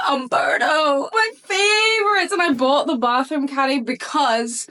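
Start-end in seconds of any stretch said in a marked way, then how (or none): 0.1-2.3 high, slow, shouted thrill at the brand name; crying out with delight over the gift; excited squeal. Real, not acted. Unboxing a surprise package from her favorite hair brand, a thrilled cry.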